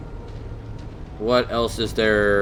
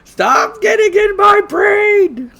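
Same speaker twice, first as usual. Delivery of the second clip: much higher, slow, yelling cartoon panic; mock panicked shout; 7 dB louder, much slower than usual. [name], tinfoil hat on, yelling at Ruby in mock panic. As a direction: scream it out like a paranoid in a tinfoil hat.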